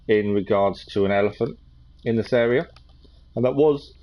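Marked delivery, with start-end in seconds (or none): 3.4-3.9 talking through a yawn; stifling a yawn mid-sentence. Real, not acted. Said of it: "sorry, I'll be yawning".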